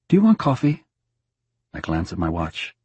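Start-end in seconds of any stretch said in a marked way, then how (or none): 0.1-0.8 bright husband chatter. Acted. Husband coaxing dead wife.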